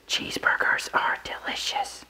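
slow whisper, very soft. Acted. Player passing the phrase to the next person in telephone, whispered. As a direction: whisper it slowly into someone's ear. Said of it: "they'd whisper into the person's ear".

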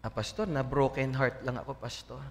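low, soft moping to the pastor; acted downcast confession, sinking away at the end; mock heartbroken. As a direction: play a dejected church member confiding heartbreak. Voicing a heartbroken church member.

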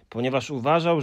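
usual speaking voice

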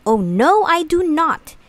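fish complaining. A narrator's reading. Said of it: "He said".

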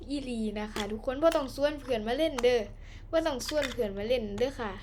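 chatty Isan dialect host talk. Acted. Host greeting viewers and starting the game, in Isan dialect. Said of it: "เริ่มพูดอีสานเลยปะ".

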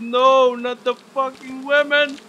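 high, loud terrified scream. Real, not acted. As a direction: scream in real terror. Jump scare in horror game.

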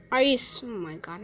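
ordinary delivery

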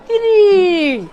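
put-on very slow, drawn-out Arabic-accented 'tiri'. Teacher showing how Arabs say three, Arabic accent. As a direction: stretch the last vowel, Arabic style. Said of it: "থ্রিকে কি বলে জানেন?".

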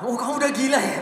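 loud, laughing "you've gone crazy" jab; mocking parent. Parent laughing loudly at the child who changed. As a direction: scoff at your child through a laugh.

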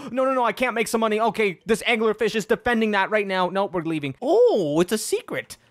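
ordinary talk, nothing marked

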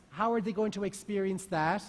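ordinary delivery

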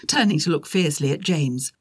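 usual speaking voice

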